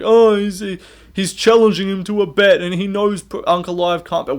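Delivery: high mocking whine of a rival's tweet; mocking Kenny.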